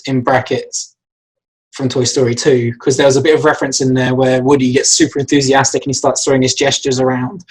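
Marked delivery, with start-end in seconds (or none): none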